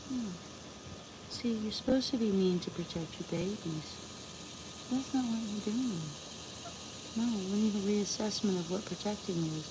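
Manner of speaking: soft, tender talk to a pet hen; gentle murmur to calm an angry hen; soothing. Real, not acted. Soothing the chicken softly.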